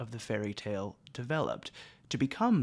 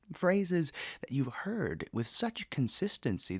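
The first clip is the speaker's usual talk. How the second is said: ordinary delivery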